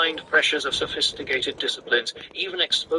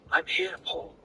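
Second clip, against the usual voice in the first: soothing mother; 4 dB quieter than usual. A narrator's reading.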